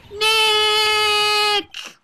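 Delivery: loud, high, held-out call of the name. Shown as a demonstration. Calling his name at the camera, loud drawn-out shout. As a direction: shout the name so the mic picks it up. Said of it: "maybe I'm not saying it loud enough".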